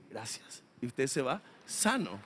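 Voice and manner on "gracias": very soft, low thanks; grateful believer. Acted. Healed believer thanking the brother, soft low murmur. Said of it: "Y usted que dice".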